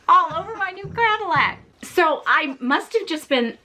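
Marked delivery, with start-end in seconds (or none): 0.0-1.6 high-pitched laughing disbelief; helpless laugh breaking into the words. Real, not acted. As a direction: squeeze the words out through laughter. Breaks up telling her embarrassing story.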